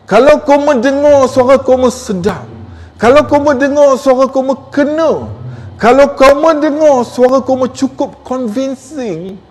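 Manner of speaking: high, projected presenter voice; high, insistent, ringing delivery. Shown as a demonstration. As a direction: raise pitch and drive each phrase home. Keeps up the projected recording voice.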